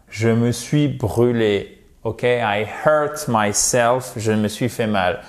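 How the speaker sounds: usual speaking voice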